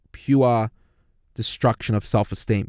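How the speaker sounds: normal talking voice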